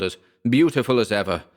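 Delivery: plain reading voice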